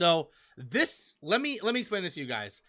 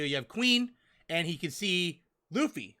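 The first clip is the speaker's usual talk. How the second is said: normal talking voice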